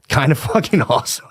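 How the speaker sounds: words breaking into laughter; real laughing. Real, not acted. Podcaster amused by his own remark on modern standards, laughing. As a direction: laugh through the last words.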